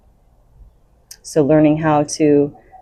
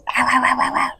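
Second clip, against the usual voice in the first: mock puppy growl "wah wah", higher; dog's angry grumble; 8 semitones higher than usual. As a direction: imitate a small dog grumbling at the big one. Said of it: "but he starts".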